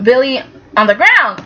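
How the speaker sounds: ordinary delivery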